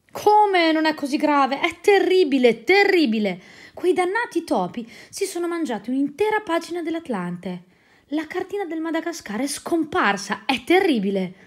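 loud, high despairing wail; high loud lament over a ruined map; distraught outcry. Acted. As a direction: cry out as if the world has ended. Diderot wails over eaten map.

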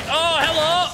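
startled high cry. Real, not acted. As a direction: yelp it out, caught off guard. Startled by incoming rocket.

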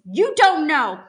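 loud, high, drawn-out protest; tearful outcry. Acted. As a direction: cry out in desperate accusation, slowly. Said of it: "she cries, tears streaming down her face".

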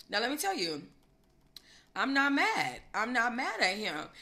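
ordinary talk, nothing marked

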